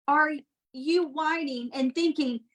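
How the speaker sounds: ordinary delivery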